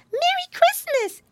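squeaky tiny piglet echo; Baby Pinky. A narrator's reading. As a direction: highest little-girl voice. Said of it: "echoed Baby Pinky".

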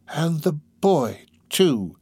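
slow, drawn-out insinuating voice. Acted. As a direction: stretch it out, fishing for a reaction.

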